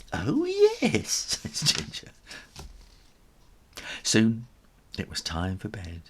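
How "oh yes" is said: very high cheerful reply; a bright little yes; high kitten voice. Acted. Ginger answers Grandad.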